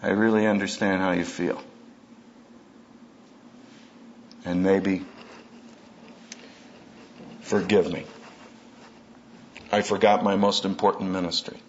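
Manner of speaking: put-on a soft, sincere apology to your kid. Shown as a demonstration. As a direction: say it gently like you mean it. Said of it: "Except to say".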